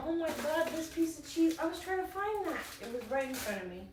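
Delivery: high, excited surprise; delighted at finding something lost; surprised. Real, not acted. Finds the missing cheese.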